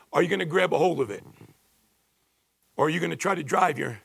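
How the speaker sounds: ordinary talk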